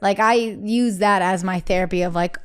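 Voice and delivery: normal talking voice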